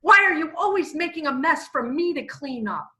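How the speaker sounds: high, loud nagging outburst; acted angry scolding, pitched high; flipping her lid. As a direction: blow up like a wife home from a foul day. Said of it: "flips her lid and says".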